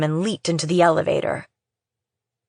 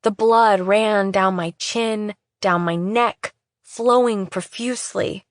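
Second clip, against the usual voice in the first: usual speaking voice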